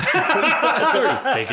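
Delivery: drawn-out laughing plea. Real, not acted. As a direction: laugh through the words. Laughing at the order.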